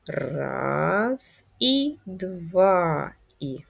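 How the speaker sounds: long held beats pacing a pen stroke; slow stretched count. Shown as a demonstration. Teacher guiding pupils writing in the air, slow drawn-out count. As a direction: count very slowly, drawing out each vowel. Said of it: "давайте ще раз".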